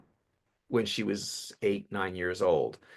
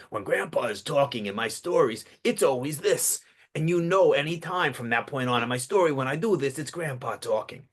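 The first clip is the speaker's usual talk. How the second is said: put-on gravelly old New Yorker, pitched up a little; gravelly grandpa; 4 semitones higher than usual. Shown as a demonstration. Coach demonstrating his grandpa character for stories, gravelly voice. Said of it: "he didn't have a gravelly accent".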